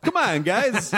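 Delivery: rallying crane worker. Acted. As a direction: urge the crew on, hammed up.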